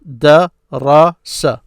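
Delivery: Darasa drawn out at half speed; teacher slowing the word for learners; slowly, syllable by syllable. Shown as a demonstration. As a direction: stretch every syllable of Darasa. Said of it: "how they broke this up".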